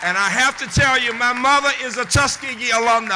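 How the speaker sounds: proud announcement. Real, not acted. Proud announcement over cheering crowd.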